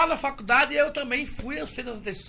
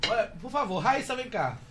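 ordinary talk

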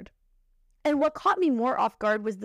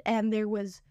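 normal talking voice

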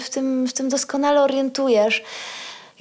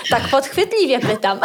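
real amused laugh through the words. Real, not acted. Host teasing the expert with a trick question, laughing. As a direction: say it while chuckling at your own question.

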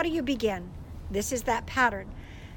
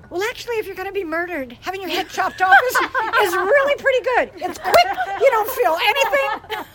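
laughing reassurance; 8 semitones higher, 10 dB louder, faster than usual. Retold or imitated. Grandma cheerfully reassures laughing.

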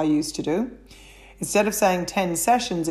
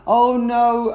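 mock dismay; 5 semitones higher, 6 dB louder than usual. Acting an excuse-making client.

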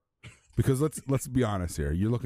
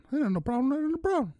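very high squeaky cartoon voice; quoting Shannon Sharpe in a Mickey Mouse squeak. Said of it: "I am gonna play it".

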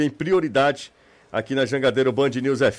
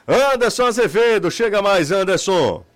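calling out; 5 semitones higher than usual. Real, not acted. Calling reporter onto the air.

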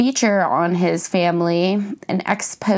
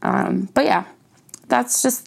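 ordinary talk, nothing marked

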